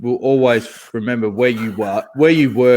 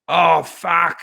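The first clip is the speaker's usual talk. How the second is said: retold curse; 6 semitones higher than usual. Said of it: "you would have heard".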